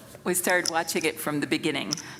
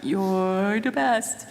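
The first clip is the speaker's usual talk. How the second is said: slow, drawn-out "You're the best." with the words held long; stretched out; 4 dB louder, much slower than usual. Retold or imitated. Mother showing how her son stretched his praise, slow drawl. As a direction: let the phrase stretch lazily. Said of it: "it would just stretch out".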